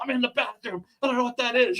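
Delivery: high-pitched mock panic; panicked last message. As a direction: report in fear from your hiding place. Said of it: "what he probably thinks is his death".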